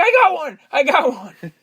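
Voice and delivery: high, loud cop's cry; mock triumphant shout. Cop announcing his one arrest, mock excited shout. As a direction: call it out like a proud catch.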